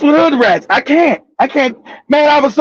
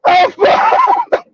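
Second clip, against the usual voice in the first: high shouted lament; despairing cry; much slower than usual. Retold or imitated. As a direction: wail it like all is lost. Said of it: "I said".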